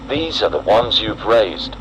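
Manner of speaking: ordinary delivery, nothing marked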